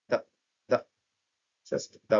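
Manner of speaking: "দা" short and weak, not a full thee; weak form the. Shown as a demonstration. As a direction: say "দা" as a quick reduced duh. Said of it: "দি উচ্চারণ না করে ওরা খুব সংক্ষেপে".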